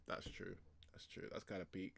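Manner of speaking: very soft, low murmur to oneself; musing aside. Real, not acted. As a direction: murmur it under the breath, conceding. Muses to self on the line.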